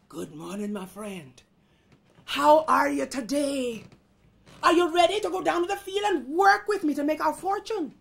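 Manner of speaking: mounting exasperation in a rooster role; frustrated rooster. Acted. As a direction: start measured, then push hard at the lazy friend. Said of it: "He's getting kinda rooster frustrated".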